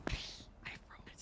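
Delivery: frozen, breathless whisper. Real, not acted. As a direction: whisper it in panic. Panics before everyone.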